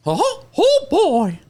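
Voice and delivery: very high, loud cartoon mouse falsetto; Mickey Mouse. Shown as a demonstration. Podcaster demonstrates his old impression, high falsetto mouse. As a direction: squeal it like a cheery cartoon mouse. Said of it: "I used to do Mickey Mouse".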